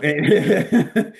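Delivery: laugh. Real, not acted. Laughing while apologising to the S4 folks, amused.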